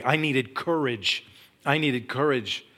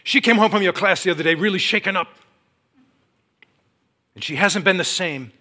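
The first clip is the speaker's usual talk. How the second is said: loud, raised accusing voice; aggressive caller; 7 semitones higher, 9 dB louder than usual. Retold or imitated. Husband complains about his shaken-up wife, aggressive. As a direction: accuse them forcefully, at full volume. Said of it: "kind of aggressive in his voice".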